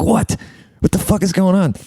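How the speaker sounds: high, disbelieving What; high-pitched stunned outburst; shocked disbelief. Retold or imitated. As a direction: recoil in shock at the news. Shocked at the revelation.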